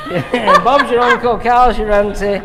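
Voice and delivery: loud, high, through laughter; laughing. Real, not acted. Stylist cracking up over a cheeky British saying, laughing.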